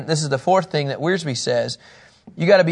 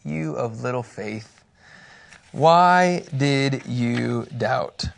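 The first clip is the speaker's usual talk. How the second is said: Jesus gently chiding his friend; gentle rebuke; 2 semitones lower, much slower than usual. Acted.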